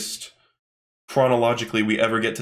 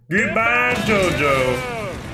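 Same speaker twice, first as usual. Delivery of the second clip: long, stretched-out battle cry; over-the-top anime villain farewell; drawn-out villain cry; 6 semitones higher, much slower than usual. Acted. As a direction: draw out each word in a high yell. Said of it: "That's literally how he says it".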